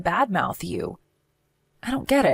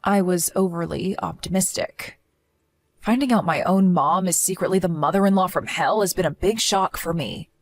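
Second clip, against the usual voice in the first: ordinary talk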